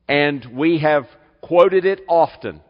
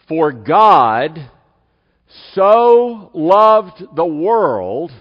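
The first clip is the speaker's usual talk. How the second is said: slow, weighty reading with long pauses and louder words; solemn scripture reading; 2 semitones higher, 4 dB louder, slower than usual. Acted. Pastor reading John 3:16 to the congregation, slow and solemn. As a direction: lay out each phrase slowly like a proclamation.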